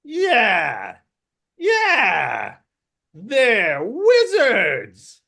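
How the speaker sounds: high, loud kobold shout; agreeing kobolds. Acted. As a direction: yell it as alarmed kobolds. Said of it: "the other ones are like".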